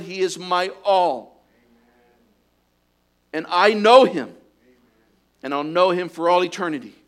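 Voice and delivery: loud, soaring preaching at full force; fervent preaching. Acted. Preacher urging his church to rejoice, loud fervent preaching. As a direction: preach it out with rising fire, loud and high.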